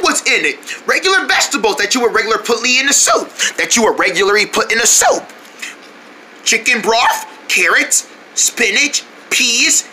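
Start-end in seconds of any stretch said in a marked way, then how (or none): none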